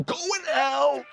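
high, urgent shout; pleading high yell; desperate yell. Real, not acted. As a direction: cry it out to the screen. Yells at the screen.

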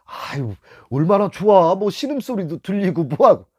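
sarcastic quoting of outsiders; mock envious outsider. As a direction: mimic people who think it's fun. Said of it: "다른 사람들은 그래".

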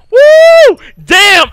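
very high, loud whooping cheer; real hyped scream. Real, not acted. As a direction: cheer like the crowd just went wild. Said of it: "I'm so hyped right now".

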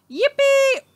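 high, loud yippee; excited yell. A narrator's reading. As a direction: yell as the pig bucks you off. Leroy thrown by bucking pig.

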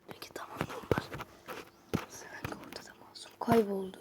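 voiceless, creepy whisper; eerie whisper. Acted. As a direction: breathe the message like a ghost. Gizemli mesajı fısıldayarak okuyor. (ürkütücü fısıltı)

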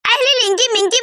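usual speaking voice

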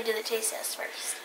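soft whispered aside; whispering. Real, not acted. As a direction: whisper it off to the side. Aside while filming.

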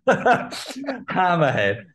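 slow, high Hammerhead drawn out through laughter; laughing. Shown as a demonstration. Fan naming his favourite figure, laughing and slow. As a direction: stretch the name while giggling.

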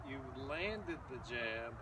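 usual speaking voice

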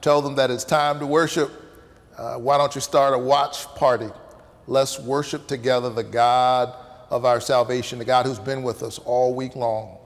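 loud, warm call to the congregation; rally the online flock to worship; pastor's invitation. Acted. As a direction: pulpit announcement voice.

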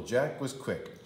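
usual speaking voice

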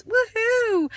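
high squealing woohoo; excited cheer. Real, not acted. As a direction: cheer it out, thrilled. Cheering about the news.

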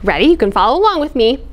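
ordinary delivery

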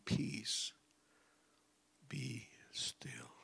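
soft whispered command; hushed calm command. Acted. Jesus calming the sea, soft whisper. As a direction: hush the storm gently.

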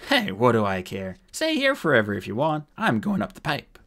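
brash, dismissive rogue; defiant dwarf. Acted. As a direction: shrug them off loudly. Voices the shrugging dwarf.

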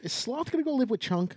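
high, whiny question; high mock wife voice. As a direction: imitate the wife asking. Mimicking wife during a movie.

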